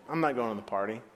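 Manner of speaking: low, quiet sulk; understated angry refusal; sullen anger. Acted. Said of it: "The older brother became angry".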